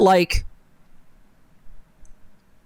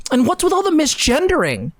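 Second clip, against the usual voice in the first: high, pitched-up disbelief; incredulous; 8 semitones higher than usual. Real, not acted. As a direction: voice climbing in outrage. Incredulous outrage.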